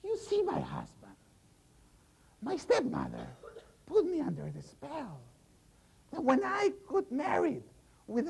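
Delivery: high, strained hag voice; the hag explaining her spell to her husband, high and strained; old hag voice. Acted.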